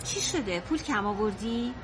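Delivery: quiet, careful question to a partner; hushed concern. Acted. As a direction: ask softly, low in pitch. Wife carefully asks about money trouble.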